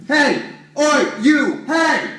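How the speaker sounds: loud, high shouts across the house; sharp loud hails; calling out. Acted. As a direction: yell for the servant to come. Calling servant loudly.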